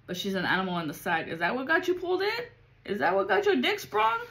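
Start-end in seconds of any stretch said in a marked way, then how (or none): 1.3-4.2 mocking high voice. Mocking incredulous questions.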